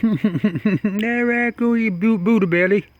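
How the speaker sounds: laughing. Real, not acted. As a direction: laugh out the words. Laughing at raccoon on back.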